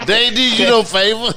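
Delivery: loud, high-pitched laughing mockery; laughing outburst. Real, not acted. Joking with a friend about a Saturday holiday, laughing loudly. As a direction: crack up while loudly ribbing someone, voice shooting up.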